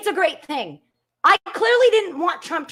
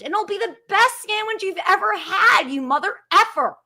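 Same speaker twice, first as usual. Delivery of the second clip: ordinary talk, nothing marked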